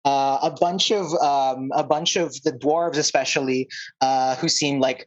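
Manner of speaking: usual speaking voice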